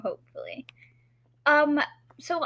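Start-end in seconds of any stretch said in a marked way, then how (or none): 0.0-0.6 hushed afterthought; whispering. Real, not acted. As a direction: whisper it to yourself. Aside after choking.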